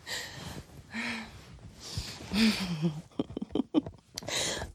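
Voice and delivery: long breathy fit of laughter; real spent, breathless laughing; uncontrolled giggling. Real, not acted. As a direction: giggle helplessly between breaths. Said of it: "laughing so much".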